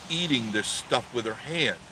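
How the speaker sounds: normal talking voice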